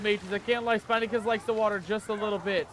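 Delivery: ordinary talk, nothing marked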